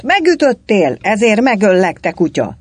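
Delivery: high, loud threat, falling at the end; big angry yell. A narrator's reading. Galamb falsely accusing the Greek of hitting him, yelling. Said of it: "Egy nagyot ordított".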